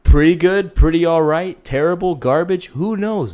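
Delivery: loud high showy list; hammed-up host listing ratings; hyped list. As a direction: announce it like a game show. Host's hyped show rundown.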